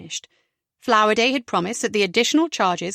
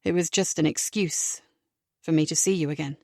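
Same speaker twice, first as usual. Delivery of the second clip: soft sincere admission; 5 semitones lower, 4 dB quieter than usual. A narrator's reading. Mervyn confessing softly.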